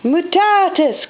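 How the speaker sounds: high, slow mock spell cry; cried out. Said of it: "cried Mickey".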